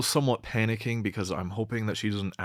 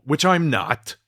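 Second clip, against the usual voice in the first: loud, higher-pitched disclaimer; emphatic denial; 8 semitones higher, 9 dB louder, slower than usual. Acted. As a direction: cut in loudly to deny it. Emphatic denial of being a pedo.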